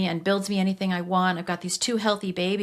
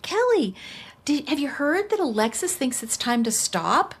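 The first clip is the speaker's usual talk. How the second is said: teasing mentor; 6 semitones higher than usual. Retold or imitated. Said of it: "she's like".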